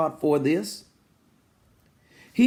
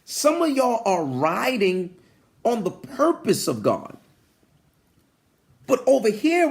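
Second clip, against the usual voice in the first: usual speaking voice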